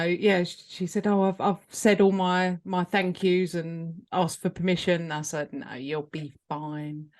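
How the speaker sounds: ordinary talk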